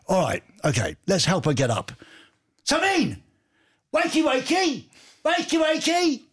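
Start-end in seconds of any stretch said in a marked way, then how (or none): 2.6-6.2 high mock shout to wake her; wake-up call. Host calling a sleepy listener awake, hammed-up shout. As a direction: bellow her awake, playful.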